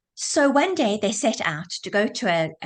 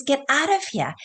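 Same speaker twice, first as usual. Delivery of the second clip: urgent, higher-pitched mock shout; mild retold shout; 6 semitones higher than usual. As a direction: half-shout a warning, voice raised in pitch. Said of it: "And he shouts".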